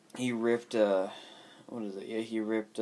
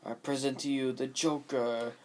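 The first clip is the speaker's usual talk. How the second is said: Tommy Wiseau; 4 semitones higher, 6 dB louder than usual. Retold or imitated. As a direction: stretch the words out in a grand mock presentation. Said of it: "I only see the fucking Tommy Wiseau video".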